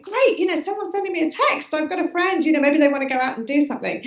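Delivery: high eager hopeful voice, pitch way up; playing up a hopeful thought; mock excited. To herself as her phone buzzes, high mock excitement.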